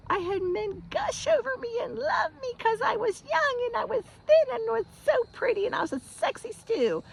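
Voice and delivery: put-on very high, girlish squeal; gushing self-mockery. Former flight attendant recalling admirers, high gushing mock voice. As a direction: gush it all in a high squeaky voice.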